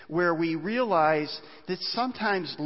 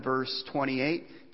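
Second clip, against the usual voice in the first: normal talking voice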